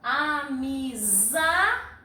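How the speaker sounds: amizade very slowly, ZA held long; stress on ZA. Shown as a demonstration. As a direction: stretch amizade by syllables, leaning on ZA. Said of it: "a sílaba tônica é". (amizade bem devagar, alongando o ZA)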